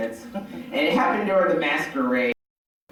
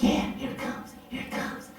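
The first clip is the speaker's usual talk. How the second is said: whispered giddy anticipation; excited fan; 12 semitones higher, 8 dB quieter than usual. Retold or imitated. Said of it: "he's like all excited".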